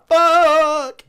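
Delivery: long, high, loud falling curse; falling yell. Retold or imitated. Friend falling down the hill, high loud falling curse. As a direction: let the fuck drop as you fall. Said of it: "the whole time he's going down".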